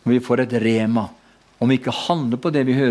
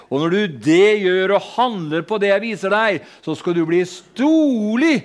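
raised, ringing prophecy in God's voice; prophetic word; 9 semitones higher than usual. Acted. Preacher voicing the Lord's word to the congregation, raised prophetic preaching. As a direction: preach it as the Lord speaking, lifted high.

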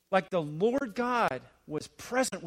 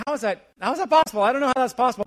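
incredulous disbelief; 5 semitones higher, 7 dB louder, much faster than usual. Acted. Voicing listeners' disbelief in storm.

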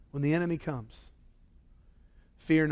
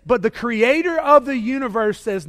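ordinary delivery

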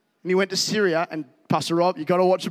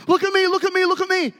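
much higher, showy "look at me" chant; mock self-promoter; 12 semitones higher, 3 dB louder, faster than usual. Preacher mocking a showy mission statement, high and hammed-up. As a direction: ham up a vain preacher begging attention. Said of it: "Thomas Jansen from Hillsong Copenhagen goes".

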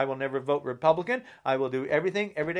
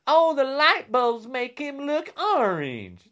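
higher, slow whiny mock quote; mocking Trump; 9 semitones higher, slower than usual. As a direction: parody the president's excuse. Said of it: "He says the light bulbs".

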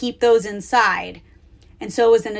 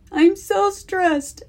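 mock stressed; 9 semitones higher, much slower than usual. Mom mocking daughter's stress.

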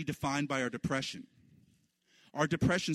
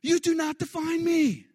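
much higher, louder, fierce; telling sorrow and grief it holds no power, shouting; defiant shout; 7 dB louder than usual. Retold or imitated. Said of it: "you're saying".

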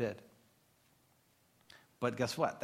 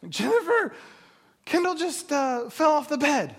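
high, halting mock-panicked phone call; imitated frantic dad, pitched way up; acted panic; 11 semitones higher, 3 dB louder than usual. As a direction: break bad news in a hammed-up frightened squeal.

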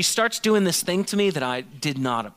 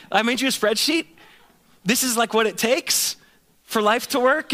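higher, louder, hammed-up salesman cheer; mock pushy enthusiasm; 8 semitones higher, 4 dB louder than usual. Pastor to wife, waving the budget in eager mock cheer. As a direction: oversell the spreadsheet, brushing her off. Pastor mocking his spreadsheet self.

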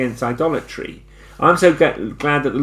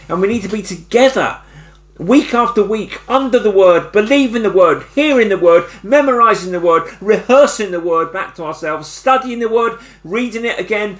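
full-force preaching; 8 semitones higher than usual. Real, not acted. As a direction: drive the list home like a revival preacher. Preaching climax, rising intensity.

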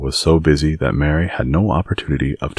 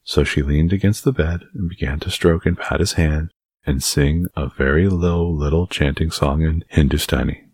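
plain reading voice

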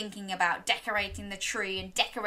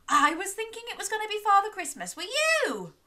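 eager high question; 11 semitones higher, faster than usual. Real, not acted. Reader asks kids eagerly.

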